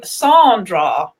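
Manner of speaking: "Sandra" with a long, open "ah", SAHN-draw; Sondra pronunciation. Shown as a demonstration. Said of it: "most people pronounce her name".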